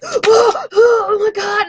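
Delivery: high, loud, near-crying oh my God; reenacted panic. To the viewers, reliving the panic over the rumor, crying out high. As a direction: relive the panic, voice breaking into a cry.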